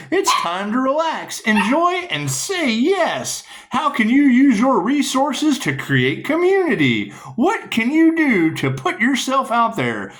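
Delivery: high, sing-song reading of the card text. Acted. Reading the card meaning aloud to viewers, high sing-song. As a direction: read the tarot card like a cheery announcement.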